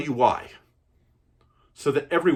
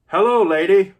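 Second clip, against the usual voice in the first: higher and louder, big and booming; hearty greeting; 10 semitones higher, 11 dB louder than usual. Acted. Fezzik loudly greets the princess from the horse. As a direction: beam and call it out.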